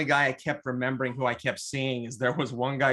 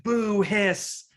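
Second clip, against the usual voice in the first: higher, louder mock boo and hiss; vaudeville boo; 9 semitones higher, 4 dB louder than usual. Crowd booing the First Order captain, mock jeering. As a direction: jeer at the villain like a vaudeville crowd. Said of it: "like a vaud".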